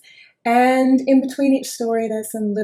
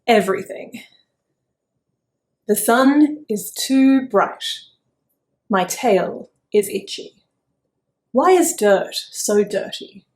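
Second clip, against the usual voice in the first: cranky chicken; 6 dB louder than usual. Acted. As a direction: grumble like a cranky little hen.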